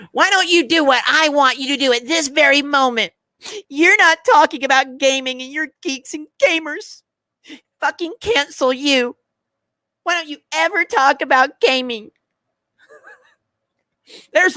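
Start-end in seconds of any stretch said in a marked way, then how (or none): 0.1-12.1 high whining mock of a demanding fan; mocking whiny viewer. Streamer mocking chat who want gaming talk, whiny high voice. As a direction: whine it like an entitled viewer, higher.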